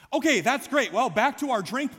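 high, loud, hammed-up dismissal; cheerfully change the subject, mockingly; mock brisk brush-off. Acts brushing off waiter's heavy confession.

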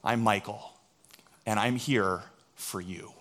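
soft, slow and gentle; a hint of a kind singer, hushed; tender greeting. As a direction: kneel down and speak softly to a boy. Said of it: "and he says".

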